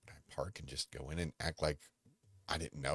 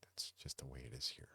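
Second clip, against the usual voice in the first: whispered reply; 3 semitones lower, 7 dB quieter than usual. Retold or imitated. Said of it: "I'm like".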